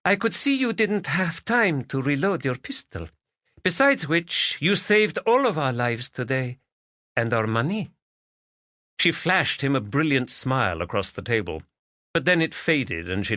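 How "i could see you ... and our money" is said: put-on high, accented Spanish woman's voice; Spanish lady. Magdalena thanking Stephen across the dinner table, accented and higher. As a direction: voice her as a lilting Spanish lady.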